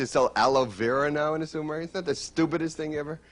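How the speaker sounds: ordinary talk, nothing marked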